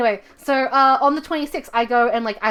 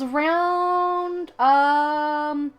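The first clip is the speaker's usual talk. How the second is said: drawn-out word; 5 semitones higher, much slower than usual. Real, not acted. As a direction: stretch "around" slow and high, "arooound". Dragging out a word while thinking.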